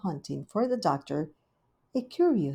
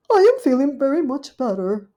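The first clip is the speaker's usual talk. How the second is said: high, loud dismayed lady dog; fretting Duchess; 8 semitones higher, 9 dB louder than usual. Acted. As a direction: wring your paws over it. Duchess wakes, insists.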